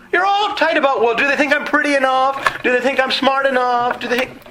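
high, fretful mock voice of a worrier; mocking the worried crowd, high and fussy; mock anxious voice.

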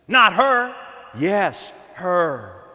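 high, loud emphatic calls; preaching shout. Real, not acted. Preacher to the church, loud and emphatic. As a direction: point them out with full voice.

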